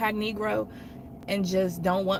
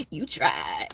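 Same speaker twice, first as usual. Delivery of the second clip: husband's father teasing; 12 semitones lower than usual. Retold or imitated. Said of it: "was like".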